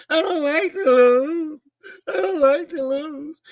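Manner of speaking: whiny high mock sob, slowed; hammed-up whimpering loser voice; mock crying. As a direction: imitate a crying sore loser. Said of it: "Your ass is crying".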